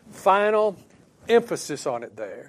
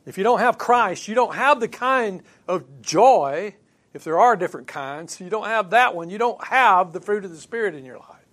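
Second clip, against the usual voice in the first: louder and higher, hammering each "don't have"; forceful preaching; 4 semitones higher, 7 dB louder than usual. Acted. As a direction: drive it home to the congregation.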